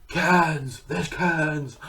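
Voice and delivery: a hint of dazed Keanu marvelling at cans; mock-awed Keanu saying cans; Keanu impression. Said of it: "he's like, "Cans".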